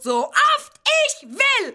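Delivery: sehr hoch, laut, gedehnt kreischend; hysterischer Aufschrei. Acted. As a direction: schrill hinausschreien. Hysterischer Ausbruch. (hoher, gedehnter Schrei)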